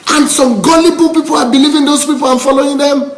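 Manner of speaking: high, worked-up rhetorical questioning; emotional. Real, not acted. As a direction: pitch soaring in indignant disbelief. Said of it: "I'm getting emotional again".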